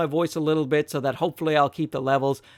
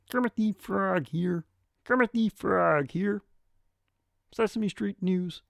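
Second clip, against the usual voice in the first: higher, slower, reedy puppet frog voice; Kermit the Frog impression; 5 semitones higher, 4 dB quieter, slower than usual. Mic reviewer doing Kermit the Frog, higher and slower. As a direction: pinch it up into a cheerful frog puppet.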